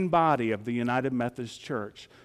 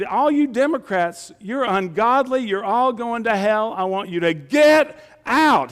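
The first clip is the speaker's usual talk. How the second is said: higher and louder, a fire-and-brimstone yell; retold preacher's yelling; 6 semitones higher, 5 dB louder than usual. Pastor imitating a YouTube preacher yelling at Democrats. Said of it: "he was yelling at his Democrats".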